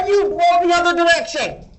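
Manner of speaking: high loud shout at the storm; shouting at wind. Real, not acted. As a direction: yell it into the wind.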